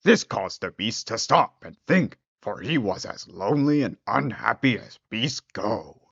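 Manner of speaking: plain reading voice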